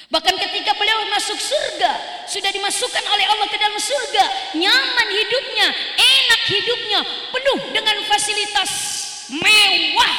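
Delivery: preaching at full force. Acted. The preacher proclaims to the congregation at full force. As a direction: build it up and shout it to the hall.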